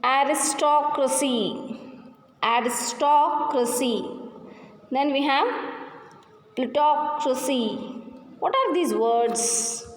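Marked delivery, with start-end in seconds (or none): 0.0-4.0 "aristocracy" with "TOC" held long; stressed syllable demo. Shown as a demonstration. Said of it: "Those are stressing syllable words". 6.5-7.8 "plutocracy" with "TOC" held; stressed syllable demo. Shown as a demonstration. Teacher gives a stressing syllable word, "plutocracy" stressed on "toc". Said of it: "Those are stressing syllable words".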